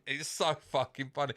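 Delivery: ordinary talk, nothing marked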